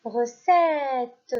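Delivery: "mallette" projected clearly; teacher calling out "mallette"; loud dictation. Shown as a demonstration. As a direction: dictate "mallette" with a falling tune. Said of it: "Je répète".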